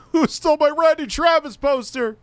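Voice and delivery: very high, loud cartoon squeal; squeaky goofy voice. Streamer voicing a clip character in a shrill squeak. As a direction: shriek it like a silly cartoon.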